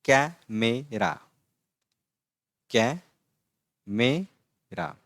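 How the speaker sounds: कैमेरा very slow, first vowel like the a in cat; teacher models कैमेरा; careful pronunciation. Shown as a demonstration. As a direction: stretch out कैमेरा for learners. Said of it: "तो ये है".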